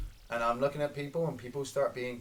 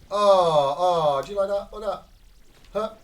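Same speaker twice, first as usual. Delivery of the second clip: loud, high delighted taunt; thrilled sniper showing off a kill; excited gloating; 6 semitones higher, 10 dB louder than usual. Real, not acted. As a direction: crow over the shot. Gloating over a kill shot.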